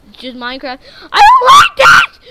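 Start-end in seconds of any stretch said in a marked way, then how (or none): 1.1-2.2 very high, loud scream with a laugh; frantic laughing scream; panicked laughing scream. Real, not acted. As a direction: shriek it half laughing in fright. Frightened at night.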